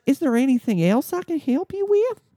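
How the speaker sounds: very high, put-on agent voice; high-pitched customer service voice. Airline agent to stranded passenger, mock high customer service voice. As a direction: imitate an agent's squeaky-high service patter. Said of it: "In her customer service voice that was high pitch".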